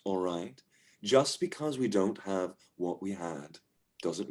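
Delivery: patient, quietening comfort; soothing. Acted. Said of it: "the soothing voice of his partner".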